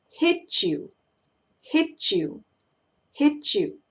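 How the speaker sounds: "Hit you" linked as "hitchoo". Shown as a demonstration. Teacher showing how T joins Y, "Hit you" as "hitchoo". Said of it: "suena como una C H".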